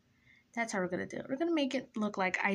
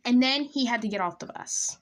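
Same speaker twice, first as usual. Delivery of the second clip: emphatic telling; 2 semitones higher, 4 dB louder than usual. Real, not acted. Teller revealing the crush left the bus, emphatically. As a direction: land the letdown with force. Same line, then dropped to quiet.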